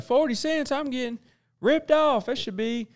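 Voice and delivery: high, outraged customer complaint; whiny customer. Retold or imitated. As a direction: squeal it like a cheated buyer. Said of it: "Somebody's gonna be like".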